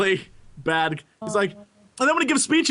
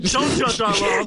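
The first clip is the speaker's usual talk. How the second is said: put-on voice. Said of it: "that's my voice acting".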